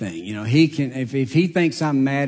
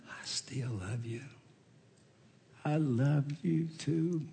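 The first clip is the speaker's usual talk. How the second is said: much softer, slow and tender; 3 semitones higher, 11 dB quieter, slower than usual. Retold or imitated. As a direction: say it like holding a child close. Father and son embrace, tender words.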